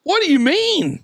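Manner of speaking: high-pitched squawk of protest; mock indignant. Seller answering friend who called them uppity, mock indignant. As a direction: protest the teasing in a high, incredulous voice. Teased as being too uppity.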